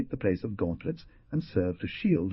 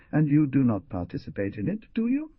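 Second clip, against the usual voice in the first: quick, bright question rising high; lively; 7 semitones higher, 4 dB louder than usual. A narrator's reading. Young man presses Carmela with lively questioning. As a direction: press him eagerly. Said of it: "asked the young man with vivacity".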